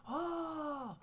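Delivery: high mock crowd "Oh!"; crowd cheer. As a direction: voice the hyped crowd behind. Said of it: "everybody in the background's like".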